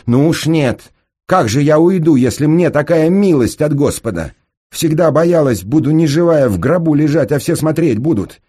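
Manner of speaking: high, loud, fervent outburst; impassioned refusal. Acted. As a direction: refuse with all your passion, at full voice. She passionately refuses to leave. (страстно, высоко и громко)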